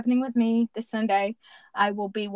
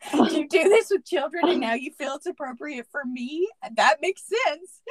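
laughing; 6 semitones higher, 5 dB louder than usual. Retold or imitated. Re-says her question, laughing.

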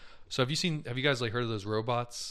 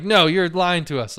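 louder, higher, slow accusation; mock accusing guest; 5 semitones higher, 9 dB louder than usual. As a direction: imitate the guests insisting she lies. Retold guests accusing the homeowner.

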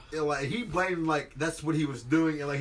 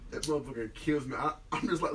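ordinary talk

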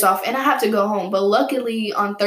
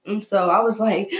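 normal talking voice

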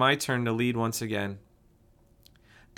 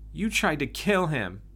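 higher, sharper accusation; angry; 6 semitones higher, 3 dB louder than usual. A narrator's reading. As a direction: accuse him with mild anger. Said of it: "she said angrily".

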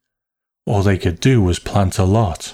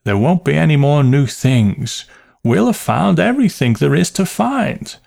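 high-pitched, absent-minded aunt; vague aunt; 7 semitones higher than usual. A narrator's reading. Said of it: "a sign of her recurring vagueness".

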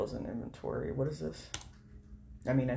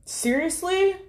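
much higher, louder disbelief; can't believe it; exasperated disbelief; 9 dB louder, slower than usual. Real, not acted. Another stale listing.

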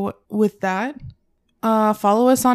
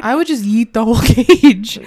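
laughing hard, loud; 8 dB louder than usual. Real, not acted. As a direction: laugh through the line. Joking about yeeting the cage.

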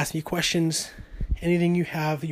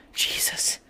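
ordinary talk

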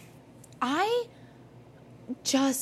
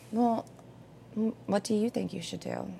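soft, level counselor tone, quieter; calm therapist voice; 2 semitones higher, 8 dB quieter than usual. Retold or imitated. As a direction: gently turn the question back to them. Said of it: "And I get this therapist voice".

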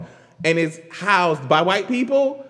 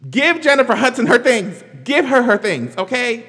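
louder, higher insistent demand; emphatic praise; 6 semitones higher, 6 dB louder than usual. Real, not acted. Demanding credit for [name], loud and insistent. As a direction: insist everyone give her credit.

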